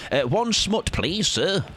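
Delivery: polite customer request. Acted. Acts out asking at the library.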